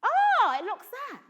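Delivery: put-on high, loud burst in a Somerset accent. Trying on a Somerset accent for an old character, Somerset burst. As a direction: pop out the Somerset accent with a sudden yelp. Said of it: "Sometimes I might put on a Somerset".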